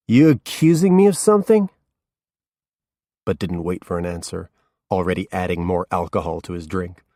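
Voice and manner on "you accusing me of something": put-on much higher, louder drunken demand; drunk challenge. A narrator's reading. Drunk Norman challenging the detective, loud and high. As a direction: bristle at him, loud.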